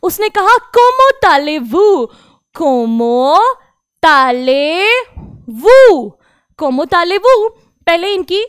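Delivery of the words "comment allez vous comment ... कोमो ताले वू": Comment allez-vous split into slow syllables कोमो ताले वू; slow French model; French pronunciation drill. Shown as a demonstration. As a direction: break the phrase into slow parts. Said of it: "pronunciation देख लेते हैं".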